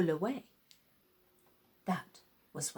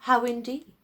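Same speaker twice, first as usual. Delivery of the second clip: ordinary delivery, nothing marked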